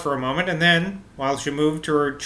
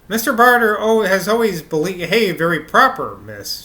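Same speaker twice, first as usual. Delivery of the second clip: higher, louder flustered stammer; 4 semitones higher, 5 dB louder than usual. A narrator's reading. Maid defends Mister Barter to miss, stammering. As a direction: trip over words while protesting. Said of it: "she stammered".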